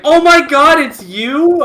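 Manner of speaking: high, loud gasp of recognition; surprised recognition. Retold or imitated. Retelling the teacher seeing a former student again, high surprised exclamation. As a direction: act out a startled teacher spotting an old pupil.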